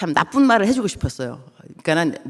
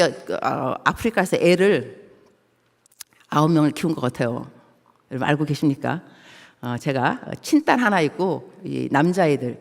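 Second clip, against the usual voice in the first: normal talking voice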